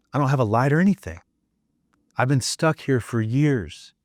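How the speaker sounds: lightly voiced hurt child. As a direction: plead like the abandoned little you. Speaker voices his hurt child self.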